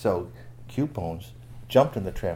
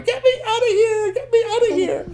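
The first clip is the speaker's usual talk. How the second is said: high, loud cries for help; caged storybook character calling out; panicked captive; 8 dB louder than usual. Acted. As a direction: plead frantically in a squealing high voice. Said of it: "Weak Knees was up in the cage going".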